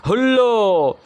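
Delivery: father's hearty exclamation of surprise; surprised hello. A narrator's reading. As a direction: call it out in astonishment, stretched out. Father's exclamation on finding children.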